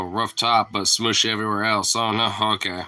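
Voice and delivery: ordinary talk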